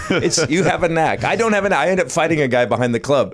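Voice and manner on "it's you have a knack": ordinary delivery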